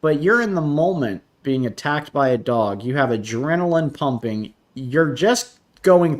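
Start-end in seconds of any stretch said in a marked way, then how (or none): none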